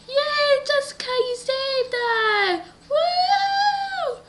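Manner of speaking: very high, squealed celebration; hammed-up cheering. Rescued family parties with their hero, high cheering.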